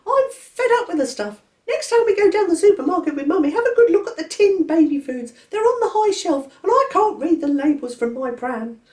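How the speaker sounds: put-on baby George complaining about milk. A narrator's reading. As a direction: grumble it like a fed-up baby. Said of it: "he said to Laura".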